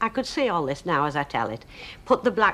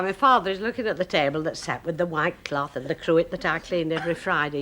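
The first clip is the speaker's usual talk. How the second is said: usual speaking voice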